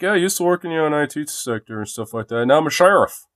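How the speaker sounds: high, loud mock coworker voice; mock coworker quote. Telling about a coworker turned sheriff, high loud mock quote. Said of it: "It's like".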